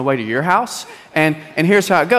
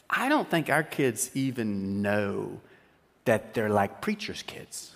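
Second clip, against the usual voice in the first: softer, lower, slow musing; quiet wife aside; 8 semitones lower, 9 dB quieter, slower than usual. Retold or imitated. Wife musing to her husband after the talk, soft and slow. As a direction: say it almost to yourself. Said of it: "she goes".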